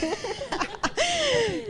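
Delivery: high bright burst of laughter. Real, not acted. Se ríe tras terminar la universidad. (carcajada aguda)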